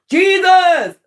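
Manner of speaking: high, loud desperate call of Jesus!; panicked cry to Jesus. Retold or imitated. Disciple on the rocky boat crying out to Jesus, loud and high. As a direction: cry out for rescue from a storm-tossed boat. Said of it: "calling on Jesus".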